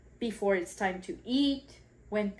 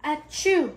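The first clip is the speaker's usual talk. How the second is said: loud acted sneeze; big pretend sneeze; 6 semitones higher, 6 dB louder than usual. Acting out sneezes in story.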